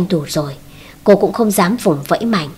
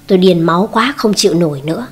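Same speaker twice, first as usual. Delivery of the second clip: plain reading voice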